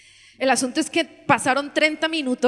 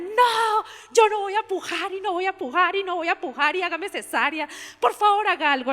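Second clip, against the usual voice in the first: desperate pleading; 8 semitones higher than usual. Retold or imitated. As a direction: beg the anesthesiologist for help. Daughter begging anesthesiologist.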